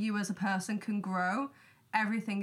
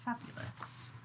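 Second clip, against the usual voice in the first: whispered fabulous; 9 dB quieter, slower than usual. Real, not acted. After awkward pause.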